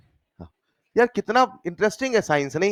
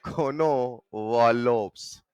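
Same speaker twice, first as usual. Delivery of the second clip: कोनो वॉलोव्स stretched very slowly and lower; drawn-out name; 7 semitones lower, much slower than usual. Shown as a demonstration. Teacher answering his own question for the class, drawn-out name. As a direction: draw out each part of कोनो वॉलोव्स.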